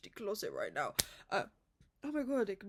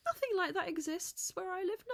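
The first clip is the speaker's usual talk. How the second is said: excited emphasis; 8 semitones higher than usual. Real, not acted. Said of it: "repeats it".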